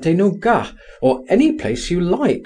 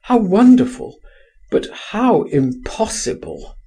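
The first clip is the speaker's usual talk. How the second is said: crying out; 8 semitones higher than usual. A narrator's reading. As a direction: exclaim in wonder, then fall away. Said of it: "he cried".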